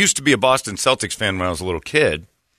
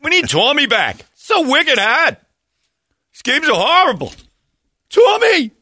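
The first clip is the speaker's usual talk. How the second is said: put-on high, loud wailing Boston accent crying for Tommy; hammed-up Boston accent, crying out for Tommy; whiny Boston fan; 3 dB louder than usual. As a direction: wail like a devastated Boston fan. Mocking Boston fans after loss.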